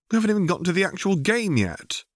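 put-on high, quick whiny boy's voice; rushing out a child's complaint; whining. A narrator's reading. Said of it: "Obi-Wan whines".

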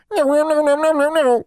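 rapid, high, loud elf refusal; frantic little creature protesting; exclaiming house elf. A narrator's reading. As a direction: squeal it in horror. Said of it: "he exclaimed".

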